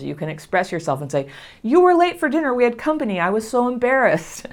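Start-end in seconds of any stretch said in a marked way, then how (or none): 1.6-4.5 overreacting complaint at dinner; upset complaint. Shown as a demonstration. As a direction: blurt out the hurt.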